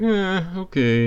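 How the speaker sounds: high, slow, deflated okay; a squeaky, defeated little okay; mock-meek high okay; reluctant okay. Reluctant reply to boss.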